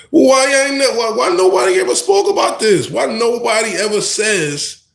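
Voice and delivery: high-pitched exasperated complaint; indignant questioning. Acted. Acted indignant question to community.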